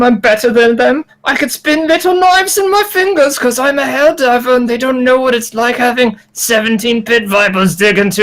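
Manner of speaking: a slow, hint of Irish accent; lightly put-on Irish tough guy, slow; slow mock Irish brag; faux Irish accent. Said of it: "whatever Irish accent he had".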